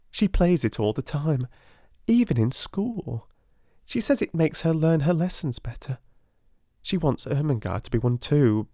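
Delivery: put-on high girlish schoolgirl voice; teasing Jessie. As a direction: play the gossipy younger girl.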